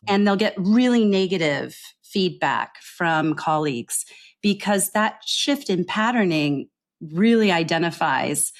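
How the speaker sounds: ordinary delivery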